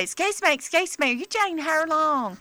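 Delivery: high, eager fan calling out politely; eager stranger. Retold or imitated. Grocery store woman stopping the comedian, high and eager.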